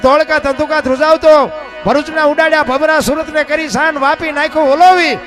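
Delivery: loud, driving folk chant building from steady to full force; rhythmic poem recital. Acted. Folk performer chants Bhikhabhai's earthquake poem to the crowd, loud rhythmic chant. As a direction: pound out the verses like a bard over music. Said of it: "એ બાપુ એ અમારા ભીખાભાઈ રબારીએ લખ્યું છે".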